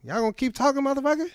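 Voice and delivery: high, exasperated mock Jackson; Samuel L. Jackson. Comic voicing the actor annoyed at a long service, high. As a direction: whine it up high, fed up. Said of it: "be like".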